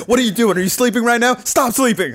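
high mock hustle-guru rant; Gary Vee parody. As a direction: badger them like a hammed-up motivator. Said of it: "we make fun of that Gary Vee".

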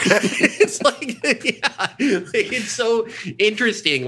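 high voice bubbling with laughter; laughing. Real, not acted. Amused at how the Bible drops her, laughing. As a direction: laugh through the words.

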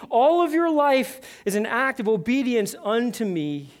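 high, loud, emphatic sermon delivery; full-force preaching. Acted. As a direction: preach it out as Christ's urgent plea. Preaches Jesus's commands.

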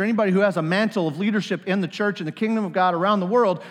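ordinary talk, nothing marked

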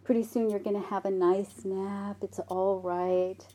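soft, soothing mother voice; calm a crying child kindly; tender reassurance, slowing at the end; gentle, kind mom. Retold or imitated. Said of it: "very gently and kindly says".

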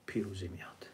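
a sudden whispered reveal; dramatic whisper. Acted. Preacher revealing that victory comes, whispered. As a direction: hush it out as the payoff.

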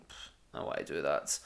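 put-on low, dismissive inner voice of a teenage lad; younger self thinking. Teen self dreaming of easy money.

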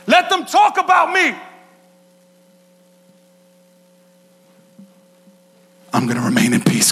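high, loud proclaiming; preaching shout. Real, not acted. Pastor shouting to his congregation, full force. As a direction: shout it out to the church.

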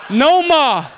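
high, loud, drawn-out "NO-MAH"; over-the-top Boston shout; Boston-accented yell. Acted. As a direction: holler it in broad Boston. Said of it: "People in Boston".

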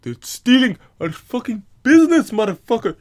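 hammed-up furious cab driver; mock angry cabbie. Cab company complaining about Uber, mock furious yell.

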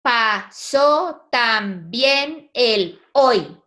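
very slow, every syllable held apart. Shown as a demonstration. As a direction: count out "Pasó también el hoy" in drawn-out separate syllables. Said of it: "Cuéntanos". (muy despacio, sílaba por sílaba)

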